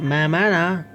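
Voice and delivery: ordinary talk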